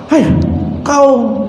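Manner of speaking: high, scolding thief voice; mock scolding. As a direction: scold the sleepy guard. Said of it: "Langsung malingnya marah-marah". (maling memarahi santri penjaga)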